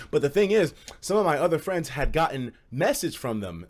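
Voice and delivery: normal talking voice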